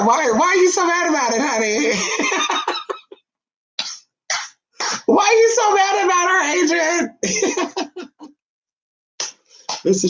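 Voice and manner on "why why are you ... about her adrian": high, sing-song tease, a bit fast; teasing. Real, not acted. Host teasing a jealous viewer, high and playful. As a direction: needle him playfully.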